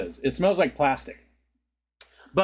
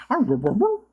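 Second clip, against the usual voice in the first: high growly dog noise; 11 semitones higher than usual. Shown as a demonstration. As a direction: make a silly little dog sound way up high.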